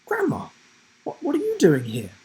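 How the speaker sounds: put-on startled boy, high squeak on Grandma; surprised. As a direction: caught off guard by his grandmother. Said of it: "he asked in surprise".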